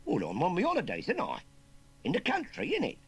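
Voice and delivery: put-on high, loud Cockney kid; cheeky Cockney youngster. Nephew explaining his holidays to uncle, cheeky Cockney. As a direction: pipe up loud and cocky.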